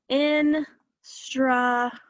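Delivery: "instruments" slowly, a gap between its three syllables; broken apart. Shown as a demonstration. Teacher breaking the word apart for students, "instruments" syllable by syllable. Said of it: "Let's break it apart".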